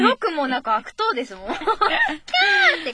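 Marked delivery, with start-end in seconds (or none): 1.5-2.9 slow, higher, giggling squeal; laughing mock squeal.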